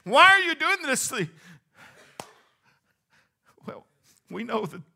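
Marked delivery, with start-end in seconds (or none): 0.0-1.3 put-on high, loud, desperate child cry; imitating a child's wail. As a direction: wail like a child getting a shot. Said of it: "they're crying because they're saying".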